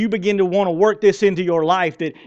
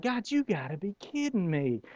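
muttered disbelief; 11 dB quieter than usual. Retold or imitated. Retold reaction to God's call.

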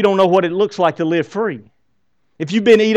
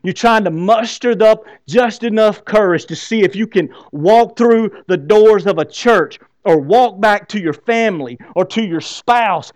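normal talking voice

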